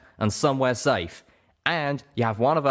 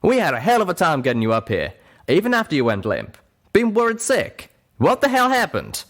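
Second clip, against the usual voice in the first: louder, higher worried scolding; 10 semitones higher, 5 dB louder than usual. Acted. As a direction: scold a friend out of fear.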